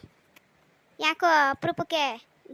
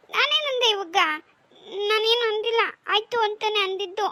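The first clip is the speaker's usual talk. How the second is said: put-on high, loud, tearful child protest; 6 semitones higher, 5 dB louder than usual. Scolded daughter protesting to her mother, high tearful cry. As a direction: wail your innocence, close to tears. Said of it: "ಇವಾಗ ಅಳ್ಬೇಡ".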